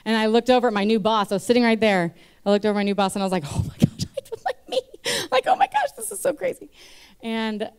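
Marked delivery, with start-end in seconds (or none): 3.5-6.7 very high, giggly gasp of shock; laughing disbelief. Retold or imitated. Convert retelling her shock beside new boss, laughing. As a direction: squeal it through laughter.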